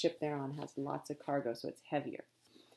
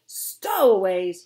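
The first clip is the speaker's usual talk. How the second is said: slow furious shout, much louder; roared; 3 semitones higher, 14 dB louder, slower than usual. A narrator's reading. Captain roars in fury at the news of stowaways. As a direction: bellow it with rage. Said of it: "he roared".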